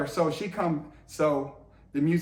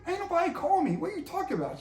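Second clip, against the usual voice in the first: much higher, playing innocent; mock innocent surprise; 13 semitones higher, much faster than usual. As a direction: act baffled by the accusation. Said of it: "I'm like".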